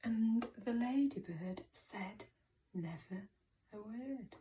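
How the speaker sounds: very soft, slow, near-whispered; hushed reading. Shown as a demonstration. Teacher reading the story to pupils, hushed. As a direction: hush it down, word by word.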